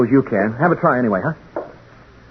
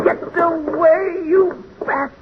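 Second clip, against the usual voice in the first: much higher, slow yelp at the bat; startled; much slower than usual. Acted. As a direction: shoo the bat off in a high panic. Bat flying at his face.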